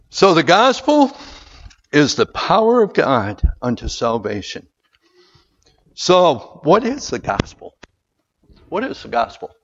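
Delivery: loud, high preacher's call; rising sermon fervor; full-force preaching. Acted. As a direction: preach it out to the whole room.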